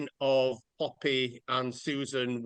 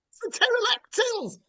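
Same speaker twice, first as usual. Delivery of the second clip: much higher, squeaky mock voice; mock squeaky Trump; 3 dB louder than usual. Retelling Trump's absurd claim to podcast friends, squeaky mock voice.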